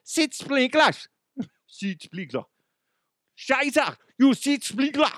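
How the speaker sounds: high, hammed-up German accent; mock German scolding, drawn out; bad German accent. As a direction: put on a silly German accent. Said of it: "don't even know what a German accent sounds like".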